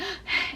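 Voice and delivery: sudden high, sharp gasp; anxious gasp. Shown as a demonstration. Coach demonstrating the anxiety step, sharp gasp. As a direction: catch your breath in panic.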